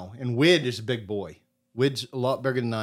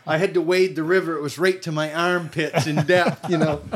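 laughing retelling; 4 semitones higher, 5 dB louder than usual. Retold or imitated. Retelling David's story, camp rolling.

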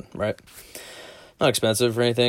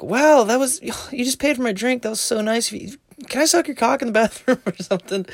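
put-on imagined girl's voice; 14 semitones higher than usual.

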